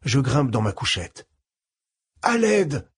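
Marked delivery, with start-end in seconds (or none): 2.2-2.9 desperate cry. Acted. Burned man calls for help.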